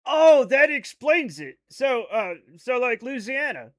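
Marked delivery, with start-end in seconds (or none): 0.0-1.6 loud, slow aha; realising. Real, not acted. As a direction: drag out the realisation loudly.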